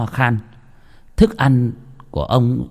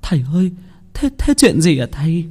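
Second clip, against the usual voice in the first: anxious wife; 6 semitones higher than usual. A narrator's reading. Frightened wife asks healer.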